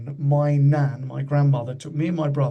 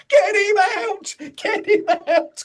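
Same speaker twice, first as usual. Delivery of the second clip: panicked shout; 5 dB louder than usual. Retold or imitated. Arkwright panicking in retold sketch.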